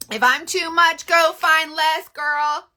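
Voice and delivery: loud, high, slow sassy retort; sassy comeback. Acted. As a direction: deliver it like a confident clapback.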